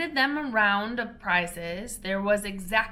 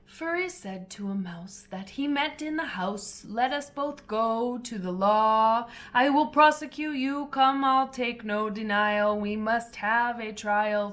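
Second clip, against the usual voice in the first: poem reading; 3 dB louder than usual. A narrator's reading. Mouse tells Alice its tale as a dramatic poem. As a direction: read it like a menacing ballad.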